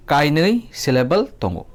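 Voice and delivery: ordinary talk, nothing marked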